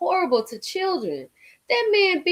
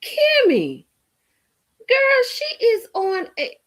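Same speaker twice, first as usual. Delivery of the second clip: excited callout; 8 semitones higher, slower than usual. Real, not acted. Calling out to viewer Kimmy.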